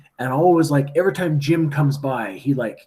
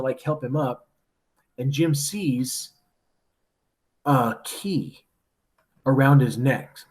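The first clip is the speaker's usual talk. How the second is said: ordinary delivery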